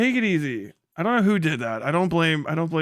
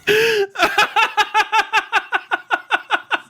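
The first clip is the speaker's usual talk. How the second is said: long high-pitched cackle; real helpless high laughter; high cackling laugh; 4 dB louder than usual. Real, not acted. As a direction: let a high giggle run out. Watching a clip of himself.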